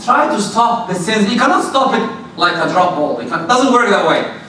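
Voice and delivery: ordinary delivery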